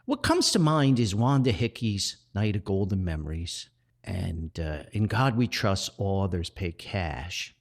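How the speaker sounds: normal talking voice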